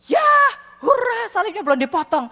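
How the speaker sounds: high, loud cry of relief; jubilant cheer. Acted. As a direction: cheer it out like a man celebrating. Story character cheers, cross intact. (sorak gembira penuh kelegaan)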